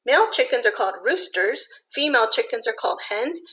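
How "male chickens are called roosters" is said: ordinary delivery, nothing marked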